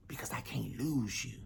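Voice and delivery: soft, frightened whisper; mother whispering her worry to her son. Acted. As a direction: let the voice drop to a breath. Mother whispering fear of loss.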